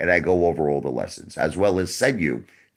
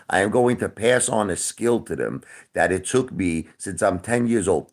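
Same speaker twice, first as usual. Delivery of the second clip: usual speaking voice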